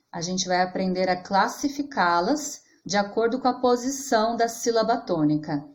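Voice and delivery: ordinary talk, nothing marked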